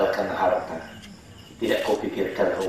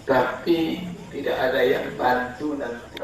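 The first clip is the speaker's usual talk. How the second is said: normal talking voice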